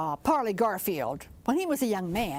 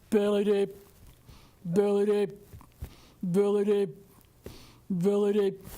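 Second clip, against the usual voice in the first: big frog answer. Acted. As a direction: croak it back like a big frog. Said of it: "they'd call back and tell him".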